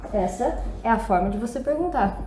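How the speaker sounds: ordinary delivery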